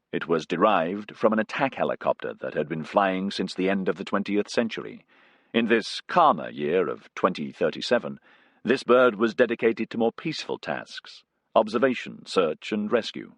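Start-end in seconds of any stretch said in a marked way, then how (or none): none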